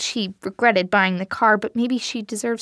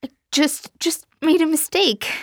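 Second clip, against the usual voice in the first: higher, stammering tearful whine; stuttering whine; 8 semitones higher than usual. Acted. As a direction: stammer it out near tears. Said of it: "a stuttering whine".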